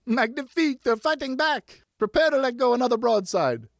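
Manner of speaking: high, loud commanding cry; rally the troops in glee; boy captain shouting orders; gleeful battle orders. A narrator's reading. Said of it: "he ordered".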